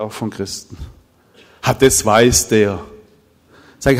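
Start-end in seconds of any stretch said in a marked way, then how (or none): 1.6-2.8 dismissive scoff. Retold or imitated. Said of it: "was ich so oft höre".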